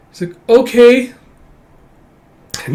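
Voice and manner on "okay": put-on high, loud little-girl voice; child's agreement. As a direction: pitch it up as the child character.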